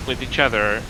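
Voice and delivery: normal talking voice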